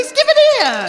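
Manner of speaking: high, loud grab for the bell; snatch it off him with a yell; snapping demand. Acted. Watchman yelling at recruit to hand over the bell.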